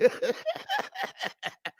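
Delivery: soft high-pitched laugh; amused falsetto laugh. Real, not acted. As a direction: laugh up high under the breath. Laughing at own impression.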